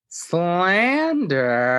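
high, long-drawn single word; mimicked outburst. Imitating a drunk castmate in the corner, high drawn-out mimicry.

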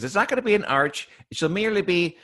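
high, theatrical villain voice; Monarch. Acted. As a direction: pronounce it like a grandiose cartoon villain. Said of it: "And the Monarch says".

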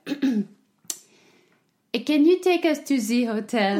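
English in a put-on French accent; thick mock French accent. Asking the cab driver in English, French accent. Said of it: "flipped the one switch for accent".

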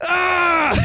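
high hammed-up scream; imitated raging "Ah!"; mock yell. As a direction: let out a high mock shriek. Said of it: "he actually goes".

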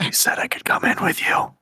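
breathy stalker whisper in your ear; creepy whisper over your shoulder. Pretend. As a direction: whisper it like someone lurking behind you.

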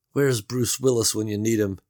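ordinary delivery, nothing marked

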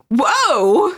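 high, loud playful whoa; mock surprise. Mimicking a reaction to a kid's growth spurt, mock amazement. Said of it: "and you're like".

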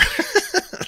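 short high-pitched chuckle; laughing. Real, not acted. Streamer confessing his sun glare excuse, laughing. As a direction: break into a high little laugh.

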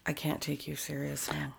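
hushed whisper, holding back laughter. Real, not acted. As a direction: whisper it under your breath. Whispering through giggly moment.

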